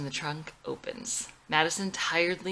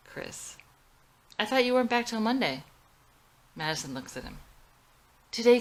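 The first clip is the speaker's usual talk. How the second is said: a touch higher, exasperated wife; annoyed, slightly raised wife's voice; lightly voiced Madison; 6 semitones higher, 5 dB louder than usual. A narrator's reading. Madison confronting Chris about not picking her up, mildly exasperated.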